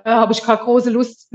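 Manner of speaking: ordinary delivery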